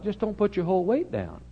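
lightly put-on high voice; mock caution. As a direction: raise the pitch as if gingerly advising. Joking advice to nervous passenger.

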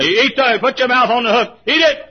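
high, full-voiced shouted orders; exasperated command. God ordering the fish onto the hook, shouting. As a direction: bellow over the objection.

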